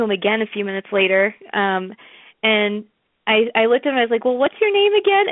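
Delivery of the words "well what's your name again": innocent question. Retold or imitated. Asks a famous producer his name.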